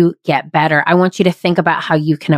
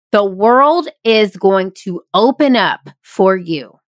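ordinary talk

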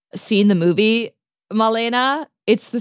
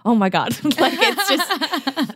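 laughing; 9 semitones higher, slower than usual. Real, not acted. Retold excited director, breaks laughing.

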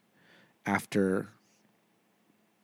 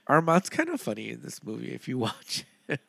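ordinary talk, nothing marked

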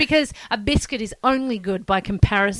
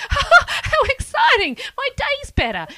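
high, slow, laughing delight; mock excitement; 8 dB louder, slower than usual. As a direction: gush over an orange cream. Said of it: "and you're like".

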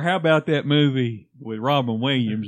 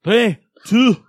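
loud Scottish countdown; 6 semitones higher, 9 dB louder than usual. Pretend.

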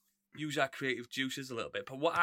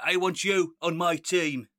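slow, louder put-on Irish accent; bad Irish accent; 3 semitones higher, 6 dB louder, slower than usual. Host imitating a talent-show judge picking his team, bad Irish accent. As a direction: do a hammy, badly faked Irish accent. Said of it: "That's my bad Irish accent as well".

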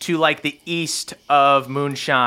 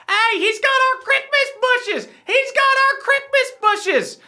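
very high, squealing elf voice; accusing crick elves. Acted. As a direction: raise the alarm as a tiny outraged creature.

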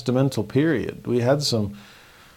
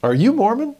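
surprised question; 12 semitones higher, 5 dB louder than usual. Retold or imitated. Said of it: "looks over and says".

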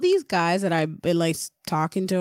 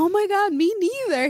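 high, louder gushing reply; excited gush; 12 semitones higher, 3 dB louder than usual. Retold or imitated. She retells texting back a single dad, excited gush. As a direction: gush with mock delight. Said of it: "I was like".

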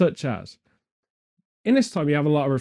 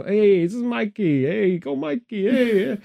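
higher, slow mock New Jersey guy; Jersey boy accent; 8 semitones higher than usual. Telling a Vatican story, voicing Michelangelo as a Jersey boy, hammed-up Jersey accent. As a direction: ham up a cocky Jersey boy greeting. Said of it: "He was a Jersey boy".

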